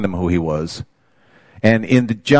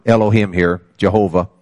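ordinary delivery

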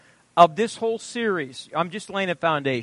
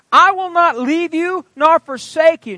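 raised, higher ringing declaration; proclaiming; 10 semitones higher, 7 dB louder than usual. Acted. Preacher quoting God to his church, ringing and raised. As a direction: lift it up as God's own promise.

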